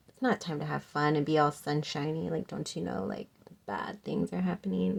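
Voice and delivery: low, quiet gloomy cartoon kid; doom-and-gloom emo kid. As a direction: mope it softly, like a serious emo kid. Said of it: "just really doom and gloom".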